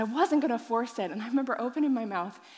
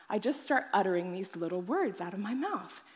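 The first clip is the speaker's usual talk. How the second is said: ordinary talk, nothing marked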